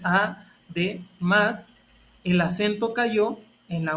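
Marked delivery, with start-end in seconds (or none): 0.0-1.7 "además" split slowly into a, de, más, pausing between; slow, measured teaching demo of "además" as a-de-más; syllable by syllable. Shown as a demonstration. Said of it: "Lo tengo separado en tres sílabas".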